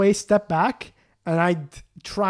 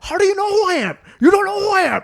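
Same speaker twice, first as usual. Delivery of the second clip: high, loud, indignant squeal of a rude customer; mock his outrage in a squeaky voice; outraged high customer; 5 dB louder than usual. Imitating the rude bank customer in a high squeal.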